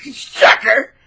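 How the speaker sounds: high, loud gloating jeer; taunting mock-villain shout. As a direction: crow it at the person just fooled. Mimics narcissist gloating after turning opinion.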